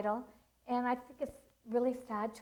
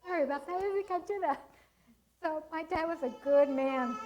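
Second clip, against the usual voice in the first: real tears, voice breaking up high; fighting back tears over her late dad; crying; 6 semitones higher, 4 dB louder than usual. Real, not acted. Grieving daughter tells the seminar about her father, tearful and breaking. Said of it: "Oh, I'm gonna cry".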